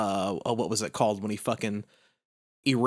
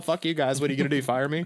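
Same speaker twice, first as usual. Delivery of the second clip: ordinary talk, nothing marked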